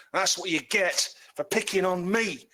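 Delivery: high, slow gloating goblin; spiteful goblin. Acted. Freed goblin gloats at the halfling, high and slow. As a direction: jeer at him like a freed goblin.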